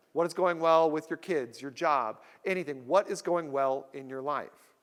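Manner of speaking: usual speaking voice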